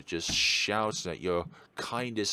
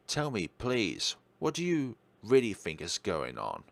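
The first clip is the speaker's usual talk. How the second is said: ordinary delivery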